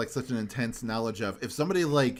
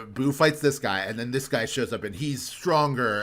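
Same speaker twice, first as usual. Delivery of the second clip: ordinary talk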